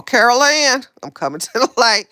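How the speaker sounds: high, loud mock-dramatic cry; hammed-up high call; playful calling out. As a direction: call out theatrically, half laughing. Calling out a film line.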